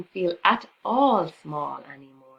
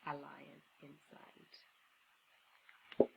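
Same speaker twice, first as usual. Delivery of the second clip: very slow whisper; slow hushed closing whisper; whispered ending; 3 semitones lower, 17 dB quieter, much slower than usual. A narrator's reading. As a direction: let the moral hang in a whisper.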